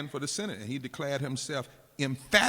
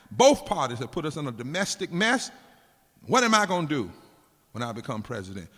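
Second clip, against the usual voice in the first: loud campaign-rally stump speech, building louder; George Wallace; 2 semitones higher, 9 dB louder than usual. Retold or imitated. Preacher voicing George Wallace's campaign rally speech, loud and rousing. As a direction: rouse the rally like a segregationist candidate. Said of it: "let me, this speech".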